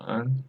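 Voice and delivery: "En" with a dipping third tone. Shown as a demonstration. Teacher reading tones aloud, "En" in third tone. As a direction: dip the pitch on "En". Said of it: "third tone".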